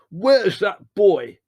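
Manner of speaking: high, slow, very loud shout; drawn-out enraged yell; furious roar. A narrator's reading. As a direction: bellow each word in rage. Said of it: "he roared".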